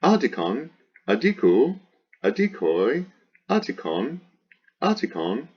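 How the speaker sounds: high singsong chant of word forms, each swinging up; paradigm recitation. Shown as a demonstration. Teacher reciting the declension, high singsong drill. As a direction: drill each form separately with an exaggerated pitch accent.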